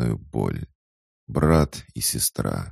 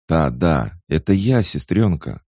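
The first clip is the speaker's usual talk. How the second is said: eager reassurance; 4 semitones higher, 6 dB louder than usual. Acted. Brother eagerly reassures sister.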